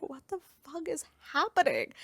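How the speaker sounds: high-pitched, incredulous retelling; bewildered disbelief. Retold or imitated. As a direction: act out a baffled what-is-going-on reaction. Acted reaction to sudden bar beating.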